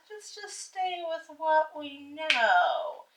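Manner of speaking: high, slow sing-song primness; stodgy mock voice. Wife mimics the steady couple they are, slow mock primness. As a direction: draw it out like cautious stick-in-the-muds.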